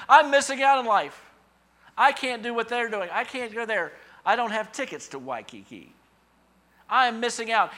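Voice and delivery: mock lament of missing out; whiny FOMO voice. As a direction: wail it like a sulky child. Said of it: "Satan likes to drop that into our thinking".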